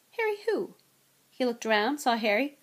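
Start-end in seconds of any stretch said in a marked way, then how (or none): 0.1-0.8 high, baffled question; blank puzzlement. A narrator's reading. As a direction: ask as if the name means nothing. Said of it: "said Mister Weasley blankly".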